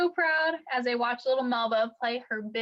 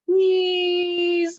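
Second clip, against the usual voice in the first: pleading, louder and higher; begging; 5 semitones higher, 7 dB louder than usual. A narrator's reading. Melba begging her mother for the horn, pleading. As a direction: beg for the trombone, drawn out. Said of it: "Melba begged".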